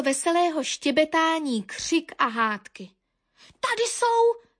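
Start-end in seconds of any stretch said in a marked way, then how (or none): 3.6-4.5 high-pitched alarm call; crying out. A narrator's reading. Young Zvonďa spots the accused tits, high outcry. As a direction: raise the cry, high. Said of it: "vykřikl Zvonďa".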